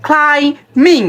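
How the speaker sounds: ক্লাইম্বিং split as "klai... ming"; two slow parts and no b in ক্লাইম্বিং; real pronunciation. Shown as a demonstration. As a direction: model ক্লাইম্বিং with silent b. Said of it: "real উচ্চারণ কিন্তু হচ্ছে".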